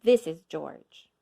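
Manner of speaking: ordinary delivery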